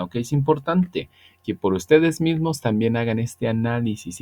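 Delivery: ordinary talk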